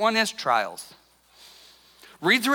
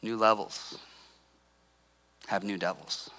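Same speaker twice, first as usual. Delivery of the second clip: hushed repeat; 11 dB quieter than usual. Shown as a demonstration. Preacher repeating the quote softly to the congregation. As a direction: let the quote sink in, softly. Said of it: "repeated quote, slow and hushed".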